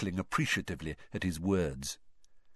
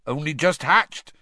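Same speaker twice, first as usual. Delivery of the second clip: louder, higher, beaming with pride; proud Hagrid; 7 semitones higher, 6 dB louder than usual. A narrator's reading. Hagrid presents hatchlings to class, proud and loud. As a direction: show off the baby creatures proudly. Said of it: "said Hagrid proudly".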